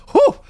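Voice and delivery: high loud whistling exhale of excitement; excited whew. Real, not acted. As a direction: blow it out like you can't contain yourself. Excited host exhales over trailer.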